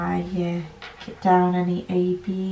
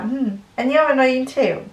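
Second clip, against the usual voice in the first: higher, sly imagined retort; sarcastic comeback; 5 semitones higher than usual. Retold or imitated. Vlogger answers a rude commenter in a sly, sarcastic retort. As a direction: toss it back with mock sweetness. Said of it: "I'm like".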